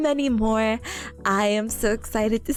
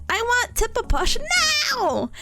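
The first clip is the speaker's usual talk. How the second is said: playful demand; 10 semitones higher, much slower than usual. Pretend.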